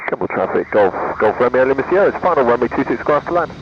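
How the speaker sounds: steady formal radio transmission; radio call. Real, not acted. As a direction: rattle off the call sign like a pilot on the radio. Pilot radio call to airfield.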